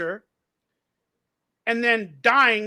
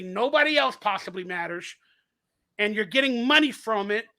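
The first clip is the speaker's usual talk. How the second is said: ordinary delivery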